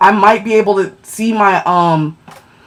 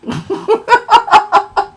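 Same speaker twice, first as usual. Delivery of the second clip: a high, breathy giggle at herself; 11 semitones higher than usual. Real, not acted. Streamer giggles after singing to her viewers, amused. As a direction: giggle at your own silly song.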